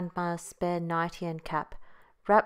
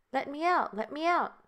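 tiny weak voice; 9 semitones higher than usual. A narrator's reading. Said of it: "came a tiny, weak voice".

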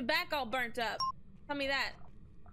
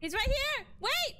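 panicked yell; 10 semitones higher than usual. Real, not acted. Gamer seeing the monster close, panicked yell. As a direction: shout in panic as he appears.

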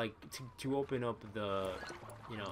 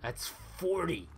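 1 slow amazement; 6 semitones higher, much slower than usual. Real, not acted. Amazed at chicken count.

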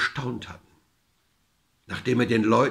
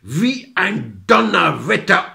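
loud, slow, thundering narration; higher and louder, drawn out for the climax; dramatic outburst; 6 semitones higher, 7 dB louder, slower than usual. A narrator's reading. Narrator unleashes the cudgel on the villagers, dramatic outburst.

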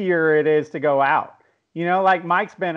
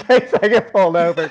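ordinary talk, nothing marked

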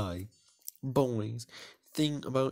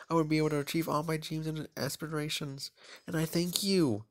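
higher, polished contestant speech; pageant answer; 6 semitones higher than usual. Acted. Beauty contestant answering the judges, higher and polished. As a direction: recite it like a rehearsed pageant answer. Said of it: "sounds like an answer from a beauty pageant".

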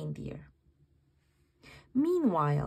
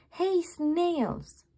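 higher, louder call; 8 semitones higher, 4 dB louder than usual. Acted. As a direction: call across to the snails. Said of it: "he called".

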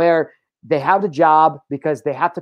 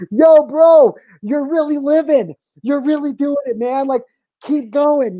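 much higher, loud hype-man cheer; hyped-up inner voice; 10 semitones higher, 3 dB louder, slower than usual. Retold or imitated. His inner observer cheering him on mid-cry, high hyped voice. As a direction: pump yourself up like a buddy cheering you on. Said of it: "my conscious observer is looking down on me going".

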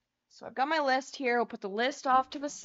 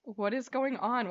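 ordinary talk

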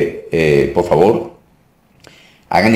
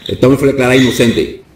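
higher, forceful declarations; deliverance preaching; 6 semitones higher than usual. Real, not acted. Pastor freeing a woman from demons, commanding preaching. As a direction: issue firm commands to cast out evil.

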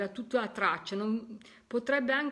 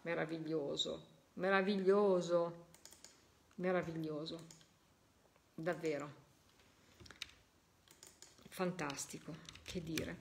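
much softer and lower, moved; hushed awe; 4 semitones lower, 6 dB quieter than usual. Real, not acted. Medium, moved after the voice, softly awed. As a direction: breathe it out, overwhelmed.

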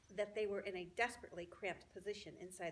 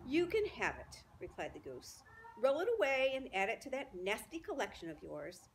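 dismissive goose; 3 semitones higher, 5 dB louder than usual. Acted. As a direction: shoo the rat away with contempt.

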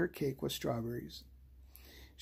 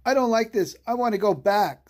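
frightened; 6 semitones higher, 7 dB louder than usual. A narrator's reading. Frightened child wants to leave.

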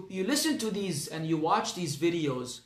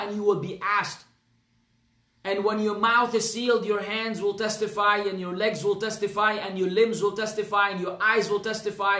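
preaching; 2 semitones higher than usual. Acted. Preaches on judgment day.

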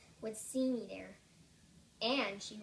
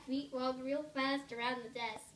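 plain reading voice

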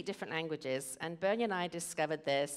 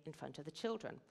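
whispered aside; 7 dB quieter than usual. Real, not acted. Staging arguments before the kids.

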